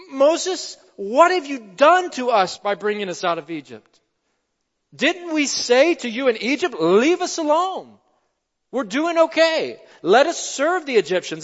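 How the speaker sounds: high, loud cry of protest; terrified complaint. Acted. Terrified Israelites cry out to Moses, protesting. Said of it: "So they were terrified and cried out".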